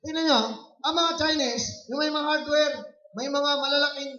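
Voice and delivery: high-pitched, projected sermon delivery; forceful preaching. Real, not acted. Preacher comparing Chinese business owners with Christians, forceful preaching. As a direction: lift the voice to drive the point home.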